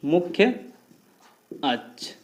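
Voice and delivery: वक्रता and त्रिज्या spoken apart, a long pause between; word by word for note-taking; slow dictation. Shown as a demonstration. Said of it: "मुख्य अक्ष के नाम से".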